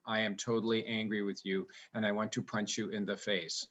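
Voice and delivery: low, soft, emotionless delivery; angry words in monotone. Shown as a demonstration. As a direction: threat droned without any inflection. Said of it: "Do our voices always sound like this".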